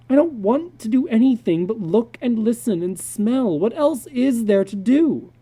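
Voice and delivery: a hint of a sulky young son; boy's voice. Son Peter to his father, a lightly voiced sulky boy.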